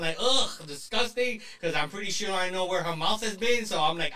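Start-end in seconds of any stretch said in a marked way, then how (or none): 0.2-1.4 disgusted. Retold or imitated. Touched her saliva, grossed out.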